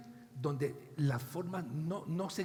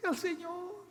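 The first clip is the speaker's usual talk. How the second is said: lightly voiced, higher begging plea; pleading Simón; 9 semitones higher than usual. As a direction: beg for their prayers. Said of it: "Y entonces Simón dijo". (súplica de Simón, voz algo más aguda)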